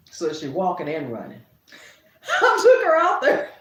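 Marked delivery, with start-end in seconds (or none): 2.4-3.5 laughing. Real, not acted. Laughing at toddler memory.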